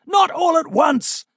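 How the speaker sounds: loud high battle shout. A narrator's reading. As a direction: bellow the order across the fight. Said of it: "the prince shouted".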